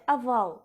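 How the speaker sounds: "aval" with unstressed o said as a, a-VAL; Russian o as a. Shown as a demonstration. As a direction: say "aval", first vowel an open a. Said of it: "comme dans le mot ovale ... en russe".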